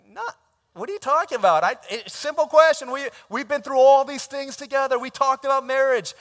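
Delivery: very high, loud squawk of disbelief; exasperated outburst. Retold or imitated. Groom outraged at his girlfriend's stalling, loud and very high. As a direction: blow up in shrill outrage.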